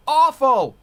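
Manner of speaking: high, loud, drawn-out complaint; emphatic disgust. Real, not acted. Complaining about sound crews at huge gigs, emphatic disgust. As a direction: belt it out as the damning verdict. Contrast with praise, exclaimed.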